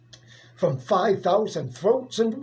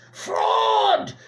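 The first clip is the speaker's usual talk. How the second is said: crowd's angry cry; 12 semitones higher than usual. Acted. Thousands of maddened fans crying at the umpire, very high shout. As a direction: hurl it like a furious crowd. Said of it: "cried the maddening thousands".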